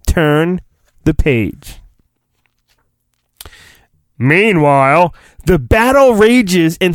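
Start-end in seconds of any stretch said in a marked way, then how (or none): none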